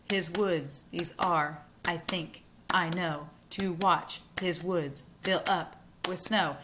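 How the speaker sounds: "His woods, these are" in short paired beats, second word stressed; hoofbeat rhythm. Shown as a demonstration. Teacher bangs out the horse's gait, rhythmic paired stresses on "His woods, these are". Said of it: "to imitate horse hoof sounds".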